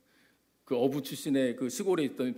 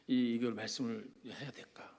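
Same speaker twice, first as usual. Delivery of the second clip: whisper; 6 semitones lower, 9 dB quieter than usual. Retold or imitated. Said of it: "고민을 많이 했어".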